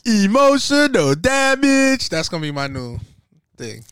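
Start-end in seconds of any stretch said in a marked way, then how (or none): 0.0-2.1 high, loud, slow shouted catchphrase in a put-on voice; comedian meme voice. Quoting the online comedian's meme line, a loud, high, put-on shout. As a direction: blare it like the viral clip. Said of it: "comedian that did that".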